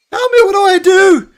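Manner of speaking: high frantic cry for help; panicked shout. Acted. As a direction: yell in panic, trapped. Trapped NPC panicking in gas.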